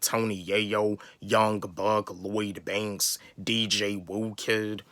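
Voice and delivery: ordinary talk, nothing marked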